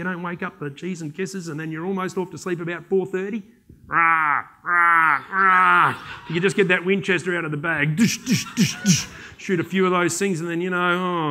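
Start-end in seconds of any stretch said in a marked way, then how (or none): 3.9-5.9 imitated rowdy racket. Imitating campers' night noise. 8.0-9.1 clipped gunshot noises through the mouth; mock gunshots. Storyteller mimes shooting the noisy campers, gunshot sounds.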